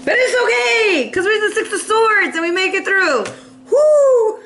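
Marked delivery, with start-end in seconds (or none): 3.7-4.4 a loud, high whoop of joy; excited whoop. Real, not acted. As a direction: cheer it out loud. Cheering over a good card.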